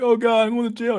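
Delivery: high, wailing mock despair; mock crying. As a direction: whine it like a man sobbing on the floor, half joking. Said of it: "one day I'll be on the ground crying".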